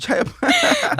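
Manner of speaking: a hint of a put-on British accent, slow; mock British accent. Shown as a demonstration. Host showing a British accent for the guest. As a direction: say the name like a Londoner.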